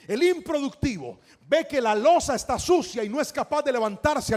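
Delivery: loud, high, driving exhortation; preaching at full force. Real, not acted. Preacher urging congregation to be hardworking, full-force preaching. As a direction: build to a booming plea for an amen.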